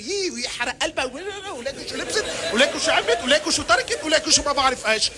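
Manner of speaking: fast, chattering mock gossip of the wives. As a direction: rattle it off like gossiping women.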